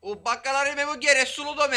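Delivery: loud, high jealous shout; angry shout. Acted.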